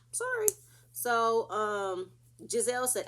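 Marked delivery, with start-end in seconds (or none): 0.1-0.5 mock sorry. Mock apology.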